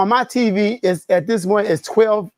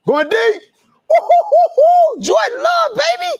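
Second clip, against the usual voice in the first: high, shouted fan celebration; excited whooping; 4 dB louder than usual. Real, not acted. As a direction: whoop and cry out, voice shooting way up. Reacting live to a big pass.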